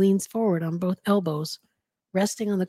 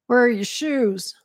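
raised, louder gruff officer's question; gruff New York cop; 5 semitones higher, 5 dB louder than usual. A narrator's reading. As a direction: demand it like a New York policeman. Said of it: "gruff voice".

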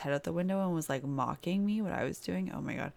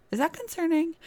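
joking worry; 13 semitones higher, 5 dB louder than usual. Real, not acted. Joking self-aware question.

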